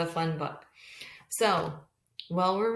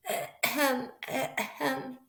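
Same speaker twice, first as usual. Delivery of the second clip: mock throat-clearing cough, pitched higher; little cough; 6 semitones higher, much slower than usual. Teacher voicing the flea's cough for the kids, small mock cough.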